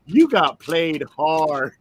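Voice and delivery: slow drawn-out gloating line; teasing dad. Retold or imitated. Dad telling his son he got tricked, slowly. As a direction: savour each word of the taunt. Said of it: "He goes".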